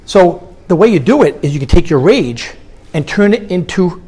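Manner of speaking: usual speaking voice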